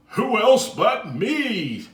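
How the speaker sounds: loud, high, slow reveal; proud letter voice. Acted. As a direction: announce yourself boldly.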